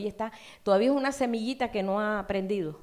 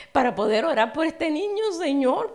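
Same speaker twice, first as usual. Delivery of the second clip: ruego más alto y fuerte; oración ferviente; 6 semitones higher, 8 dB louder than usual. Retold or imitated. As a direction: clama a Dios con urgencia. Fervent prayer for the sick baby. (orando por el bebé enfermo, con clamor ferviente)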